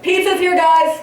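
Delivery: high-pitched fake call to the room. Lone diner pretends others are waiting for pizza, calling out high. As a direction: sing out as if to housemates elsewhere.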